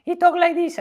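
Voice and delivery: very high, loud squeaky voice; imitating Simone's high voice. Telling the audience how his climbing partner sounded, very high and squeaky. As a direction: pitch way up like a funny little voice. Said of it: "very high and very funny".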